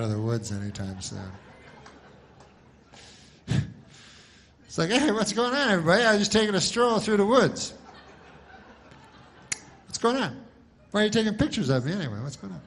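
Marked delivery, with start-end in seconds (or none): 4.9-7.7 higher, breezy put-on cheerfulness; a missing woman strolling out, mock cheerful; cheery mock Janice. 9.9-12.6 mock confusion. Confused Janice photographed.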